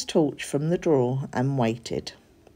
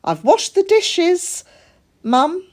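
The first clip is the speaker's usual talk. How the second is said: high, bright-eyed little cat voice, keen to please; a proud little cat showing off; young cat boasting to mum about chores; eager kid cat; 12 semitones higher, 5 dB louder than usual. A narrator's reading.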